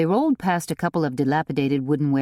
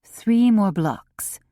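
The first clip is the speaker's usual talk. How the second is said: slow, pleading, a little higher; drawn-out plea to keep going; begging; 5 semitones higher, 4 dB louder, much slower than usual. A narrator's reading. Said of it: "begged Theodosia".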